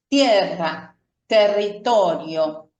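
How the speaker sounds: "tierra" con la rr fuerte, vibrando; "territorio" lento, rr marcada; rr vibrante. Shown as a demonstration. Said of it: "darle ese sonido fuerte". (rr múltiple en "tierra")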